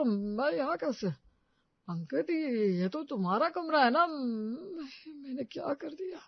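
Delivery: slow, bewildered waking voice; dazed confusion. Acted. Father just woken, dazed and confused. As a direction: drift up out of sleep, lost.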